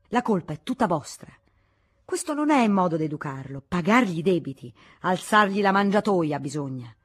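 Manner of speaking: loud, scolding monk; angry rebuke. Acted. Monk blaming the prince for his son's debts, loud. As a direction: berate them for spoiling the boy. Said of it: "cominciò a prendersela".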